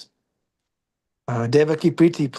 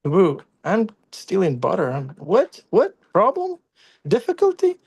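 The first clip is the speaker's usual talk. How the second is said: playful Krishna; 6 semitones higher than usual. Retold or imitated. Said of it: "Krishna looks back at you like".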